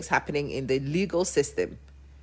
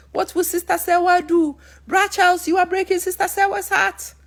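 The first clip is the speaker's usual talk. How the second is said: much higher, loud pleading preacher; preachy Papa She; 12 semitones higher, 3 dB louder than usual. Retold or imitated. Papa She scolding Bra Charles, high pleading preacher. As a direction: beg Bra Charles to repent, climbing high. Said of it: "So I'm sure he went".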